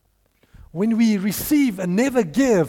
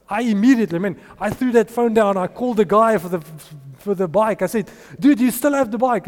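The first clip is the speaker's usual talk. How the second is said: excited; 3 semitones higher, 5 dB louder than usual. Real, not acted. Rushing to buy bike.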